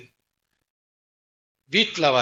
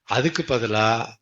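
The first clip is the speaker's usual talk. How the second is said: normal talking voice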